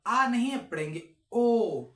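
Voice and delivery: ओ said loud with rounded lips; teacher demonstrating the ओ vowel; rounded o sound. Shown as a demonstration. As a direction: stretch out a round, loud ओ after the spoken आ. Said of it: "ये जो आपका mouth है ये गोल हो जाना चाहिए".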